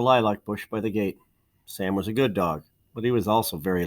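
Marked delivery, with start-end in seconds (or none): none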